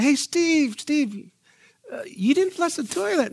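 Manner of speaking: high-pitched calling out to a friend; mock reproach pitched high; scolding call. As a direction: call him out on it, half teasing. Retold scolding roommate Steve.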